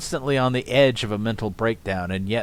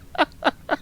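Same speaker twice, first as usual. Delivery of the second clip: high laugh. Real, not acted. Laughs at the comment.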